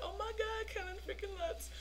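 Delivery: high, hushed fangirl awe; star-struck. Retold or imitated. Fan spotting the star close by, hushed and star-struck. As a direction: softly squeal it under your voice.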